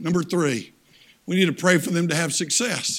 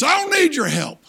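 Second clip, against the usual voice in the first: higher and louder, curt and offended; indignant refusal; 9 semitones higher, 5 dB louder than usual. Retold or imitated. Old man refusing the boy's help, angry. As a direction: shut the boy down hard.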